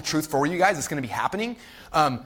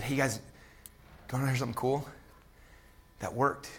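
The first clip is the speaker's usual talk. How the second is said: softer, halting retold revelation; quiet reveal; 2 semitones lower, 9 dB quieter than usual. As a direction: let the team in on it softly.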